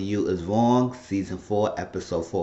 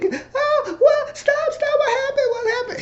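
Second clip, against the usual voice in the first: high falsetto woman's voice, alarmed; Esperanza's falsetto. Retold or imitated. Esperanza asking Steven what happened, in high alarmed falsetto. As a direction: squeak it out like a startled woman.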